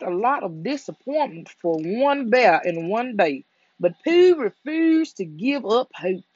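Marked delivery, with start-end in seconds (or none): none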